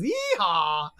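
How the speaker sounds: loud cowboy whoop; cowboy yeehaw. Acted. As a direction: holler it like a cowboy. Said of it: "Minnie Baby Bell says".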